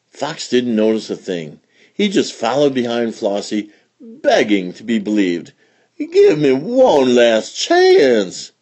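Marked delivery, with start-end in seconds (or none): 6.0-8.5 pleading. Acted. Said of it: "he pleaded".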